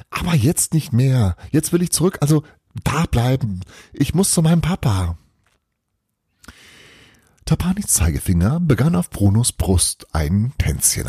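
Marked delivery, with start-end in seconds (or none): 0.1-5.2 a lightly voiced pleading child, a little higher; the boy begging to go back; hint of an anxious child. Boy's voice in story.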